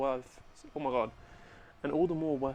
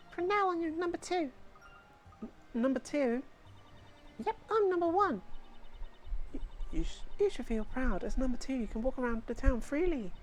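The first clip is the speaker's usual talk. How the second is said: put-on bossy kid; 13 semitones higher than usual. Kid gang leader bragging at the newcomers, higher bratty voice. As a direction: brag and snap like a little gang leader.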